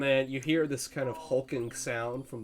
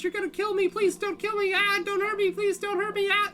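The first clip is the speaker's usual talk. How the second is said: very high, squeaky frantic bird voice; panicking bird; 6 dB louder, faster than usual. Pretend. Game master as caught bird begs its captor, panicking. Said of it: "The bird is saying".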